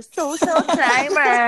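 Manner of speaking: slow, snooty put-on voice; mocking social climber tone. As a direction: drag it out like a show-off. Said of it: "Ganun pa yung tono".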